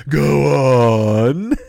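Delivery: Shakespeare greedily urging Rick to keep talking; eager prompting. Retold or imitated. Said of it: "And Shakespeare said".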